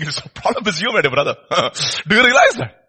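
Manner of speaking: ordinary talk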